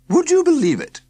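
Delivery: high, loud cheery woman's voice; a bright chatty countrywoman; woman in overalls. A narrator's reading. Woman at the tent announcing the car problem, high and loud.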